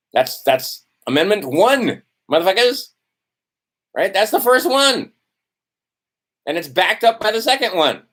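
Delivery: getting heated over the First Amendment; a little testy. Real, not acted. Talking about the Constitution, he is testy and heated. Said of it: "I get a little testy".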